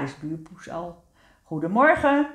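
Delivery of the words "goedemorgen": higher, louder cheerful greeting; group call. A narrator's reading. All the friends greet aunt together, raised calling voice. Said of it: "roepen ze allemaal".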